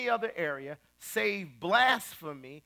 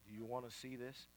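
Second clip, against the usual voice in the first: low, confidential mock TV host; hushed investigator; 7 semitones lower, 17 dB quieter, faster than usual. TV host offers client the evidence, hushed. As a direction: ask it very softly, as if on a stakeout.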